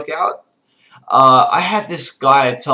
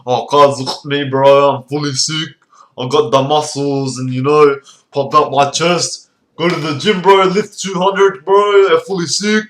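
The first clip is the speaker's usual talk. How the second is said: loud, hammed-up gym bro voice; meathead gym bro; 2 semitones higher, 5 dB louder than usual. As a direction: brag like a juiced-up lad. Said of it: "and they're like".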